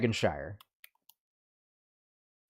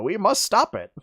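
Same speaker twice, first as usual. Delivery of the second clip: grand, dramatic warning in a raised voice; Deckard Cain; 10 semitones higher, 4 dB louder than usual. Acted. Mimicking the comic for co-hosts, hammed-up dramatic proclamation. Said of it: "but he's like".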